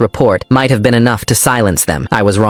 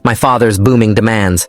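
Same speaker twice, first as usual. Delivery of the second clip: ordinary delivery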